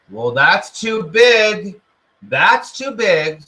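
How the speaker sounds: drawn-out doubting voice; ego's voice. Retold or imitated. The ego talking you out of a big dream, whiny and slow. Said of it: "the ego will say".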